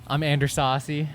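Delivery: ordinary talk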